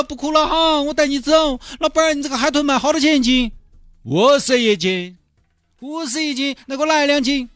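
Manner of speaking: high, sing-song comic voices haggling over the dolphin; pleading buyer and gruff vendor. Acted. Mr Chen begging the fishmonger to free the dolphin, high hammed-up pleading.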